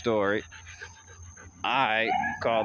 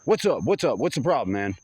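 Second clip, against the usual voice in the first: higher, louder, a touch of a confrontational cop; mock aggressive cop; 4 semitones higher, 4 dB louder than usual. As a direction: imitate the cop squaring up to you. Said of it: "really weird and aggressive".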